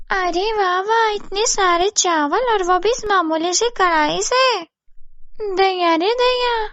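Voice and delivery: high, wondering schemer's voice; greedy, amazed neighbour. Acted. Babli covets the magic kadhai, high greedy voice.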